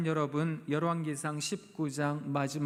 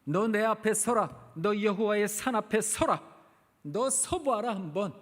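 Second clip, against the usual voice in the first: loud, higher, commanding voice of God; 4 semitones higher, 4 dB louder than usual. Acted. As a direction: call him out to stand before the mountain. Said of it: "엘리야에게 말씀하셨습니다". (엘리야에게 명령하는 하나님의 우렁찬 목소리)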